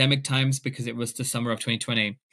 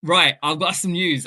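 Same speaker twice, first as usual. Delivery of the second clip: mock-formal announcement; 4 semitones higher, 6 dB louder than usual. Announcing news to parents.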